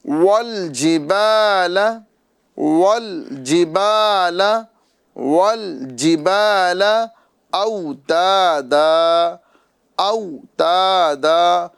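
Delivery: very slow, measured tajweed; drawn-out melodic teaching recitation; Quran recitation. Shown as a demonstration. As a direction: recite word by word, stretching the long vowels. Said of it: "آگے پڑھتے ہیں".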